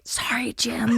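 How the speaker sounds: soft sheepish whisper; whispered apology. Pretend. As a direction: hush an apology to a grave. Apologizing on the film set.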